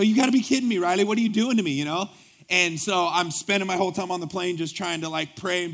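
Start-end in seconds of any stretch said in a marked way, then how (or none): 0.0-1.7 raised, louder disbelieving cry; shocked protest. Retold or imitated. Pastor protesting to the trip leader in shocked disbelief. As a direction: act out the shock of hearing the news. Shocked at being told to preach.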